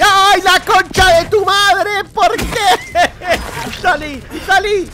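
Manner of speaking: very high, loud slow shrieking curse; panicked shrieking. Real, not acted. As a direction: shriek and shove it away. Monster jumps him. (chillidos de pánico contra el bicho)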